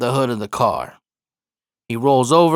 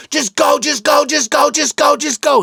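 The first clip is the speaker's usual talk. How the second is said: high, loud repeated shout; impersonating an injured friend yelling go away; 12 semitones higher, 3 dB louder than usual. Retold or imitated. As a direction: yell it over and over to drive him off. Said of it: "He's just yelling".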